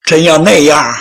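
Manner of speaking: ordinary delivery, nothing marked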